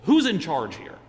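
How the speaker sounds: loud demanding shout; angry yell. Retold or imitated. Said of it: "angrily yell out".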